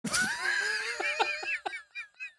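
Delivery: big burst of laughter. Real, not acted.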